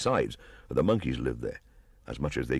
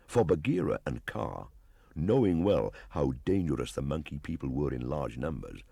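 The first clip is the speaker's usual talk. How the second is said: usual speaking voice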